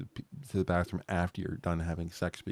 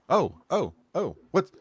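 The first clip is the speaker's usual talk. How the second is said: mock-surprised, higher halting little cries; startled bladder voice; 5 semitones higher, 5 dB louder than usual. Host voices a woman's bladder getting bumped awake, mock-startled. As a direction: play a body part jolted awake, piece by piece.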